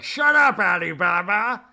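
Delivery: very loud, high mangled yell; exaggerated imitation of a shouted put-down; mock outburst. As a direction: shriek it in a hammed-up rage. Acts Meredith's yelled outburst.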